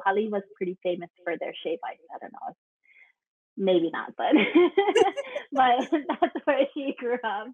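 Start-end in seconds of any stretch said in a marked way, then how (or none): 4.4-5.5 high burst of real laughter; quick high-pitched giggle. Real, not acted. Guest laughs at own aside. 5.5-7.5 higher voice through real laughter; words bubbling with laughter; laughing while talking. Real, not acted. Guest talks on through laughter.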